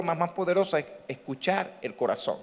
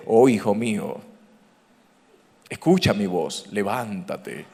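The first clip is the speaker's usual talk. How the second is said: deep, booming mock voice of God; 8 semitones lower, slower than usual. Pastor mocking how television portrays God's voice, deep and grand. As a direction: parody the dramatic movie God voice, much lower. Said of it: "una voz como la que nos ponen en la televisión".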